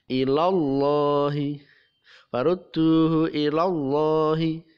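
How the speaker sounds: Farudduhu ilallahi slow with a heavy Allah; tafkhim recitation. Shown as a demonstration. Teacher reciting ilallahi with a heavy Allah. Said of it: "ini dibaca tafkhim atau tebal".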